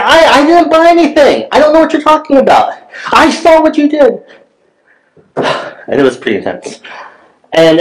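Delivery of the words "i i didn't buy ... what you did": yelling back. Retold or imitated. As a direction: shout back in heated self-defence. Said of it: "I'm just yelling right back at her".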